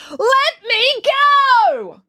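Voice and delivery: loud, very high, long-drawn scream; high movie-style scream. A narrator's reading. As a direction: scream it, stretching every word. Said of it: "She screamed".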